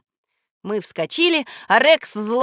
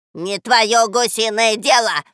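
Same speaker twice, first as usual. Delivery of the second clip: louder, slow snarl; snapping back; 7 dB louder, slower than usual. Acted. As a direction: bite back at the goose. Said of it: "огрызнулась Баба Яга".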